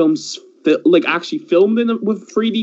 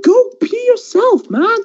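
high, slow, mocking brush-off; mock high dismissive voice; slower than usual. Retelling snapping at cousin in a high mock voice. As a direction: squeak it out like a sneering kid. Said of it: "I was like".

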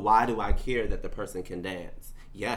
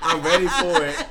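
laughing while talking; 8 semitones higher, 12 dB louder than usual. Real, not acted. Both laughing mid-outburst.

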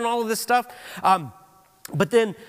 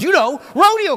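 high, loud, unfinished outburst; imitated excited blurting; 8 semitones higher, 10 dB louder than usual. Preacher mimics brother not finishing sentences, excited shout. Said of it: "that's how he talks".

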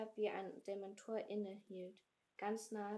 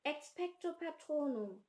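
shouted spell; 6 semitones higher, 6 dB louder than usual. Acted.

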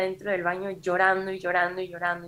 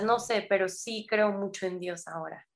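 ordinary delivery

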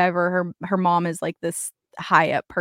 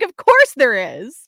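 higher, louder, drawn-out "of course"; emphatic reaction; 4 semitones higher, 7 dB louder than usual. Retold or imitated. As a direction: exclaim it slowly and loudly, as if utterly unsurprised. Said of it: "I was like".

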